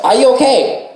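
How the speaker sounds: high shout over the rain; shouting from the back. Retold or imitated. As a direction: call back loud and high, worried. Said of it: "shouting from the back".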